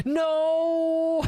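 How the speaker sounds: high, loud anguished "No!"; dismayed shout. Real, not acted. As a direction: cry out in real dismay. Forgot timer in final fight.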